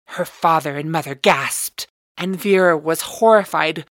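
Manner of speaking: usual speaking voice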